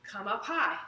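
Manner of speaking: ordinary talk, nothing marked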